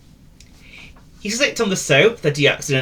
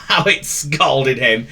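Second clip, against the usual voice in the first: usual speaking voice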